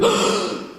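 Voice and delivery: soft sip of air; light unvoiced in-breath; quiet sipped breath. Shown as a demonstration. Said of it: "breathe like this".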